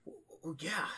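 soft, whispery stammer of recognition; hushed reaction. Acted. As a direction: say it under your breath, haltingly. Orc reacts in game dialogue.

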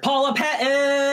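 high, drawn-out excited cheer; excited shout. Real, not acted. Fan cuts in with a favorite actress, excited shout. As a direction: cheer the name out long and high.

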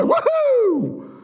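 high cheering woohoo; excited whoop. Acted. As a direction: cheer like the crowd got the news. Cheering reading of joy.

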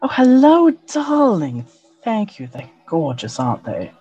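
lightly voiced sultry elf woman; a hint of a purring seductress; femme fatale. GM voices elven woman NPC.

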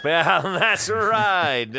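loud high laugh through the words; cracking up at the joke; bursting out laughing while agreeing. Real, not acted.

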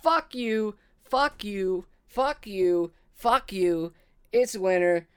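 loud, high victory crowing; gloating triumph. Real, not acted. Player gloats at the riddling dragon, loud and high. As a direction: crow over the win at the top of your voice.

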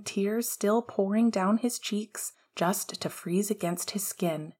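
ordinary delivery

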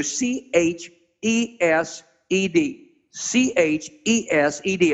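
letter by letter, slow and deliberate; spelled out slowly. Shown as a demonstration. Preacher spells the Hebrew word for the congregation, slowly. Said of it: "The word there is C in the Hebrew".

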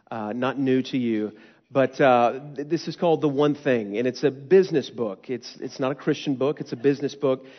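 usual speaking voice

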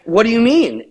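high defensive question; retold protest; mock innocence. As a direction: play it innocent, pitch lifted. Acts out their defensive reply.

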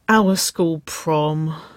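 very slow, drawn-out groan. A narrator's reading. Woman rejecting an old gown, groaning. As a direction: drag the words out in dismay. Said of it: "she groaned".

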